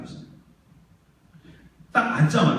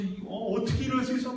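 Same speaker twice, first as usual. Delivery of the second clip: crying retold; 5 semitones higher, 5 dB quieter than usual. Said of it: "막 울면서".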